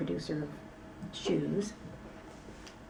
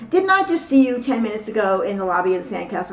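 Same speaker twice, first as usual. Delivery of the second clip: loud, raised-pitch accusing question; David Miscavige; 4 semitones higher, 11 dB louder, faster than usual. Retold or imitated. Church leader grilling a visitor in the library, hollering. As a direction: holler it like an interrogation. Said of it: "he's hollering".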